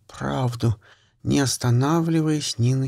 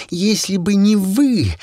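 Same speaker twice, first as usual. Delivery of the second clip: louder, higher hissing rage; furious outburst; 5 semitones higher, 5 dB louder, slower than usual. Acted. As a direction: let the fury break out. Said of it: "зашипела так".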